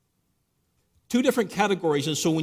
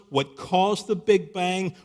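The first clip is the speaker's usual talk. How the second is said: ordinary talk, nothing marked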